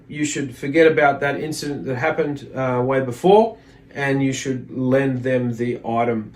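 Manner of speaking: ordinary talk, nothing marked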